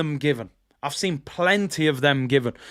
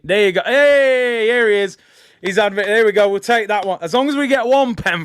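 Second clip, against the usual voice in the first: excited; 4 semitones higher, 6 dB louder than usual. Real, not acted. Referee finally awards the penalty.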